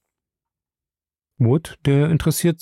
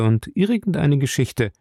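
ordinary delivery, nothing marked

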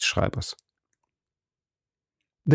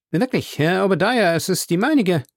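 the clerk pipes up, much higher; a small, higher clerk's voice; higher-voiced clerk; 8 semitones higher than usual. A narrator's reading. Clerk correcting the judge about the wig, higher pitch. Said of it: "erwiderte der Gerichtsschreiber".

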